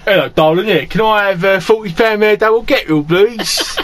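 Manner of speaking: put-on mild geezer Cockney accent at the till; chirpy Cockney geezer ordering, lightly done. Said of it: "full geezer".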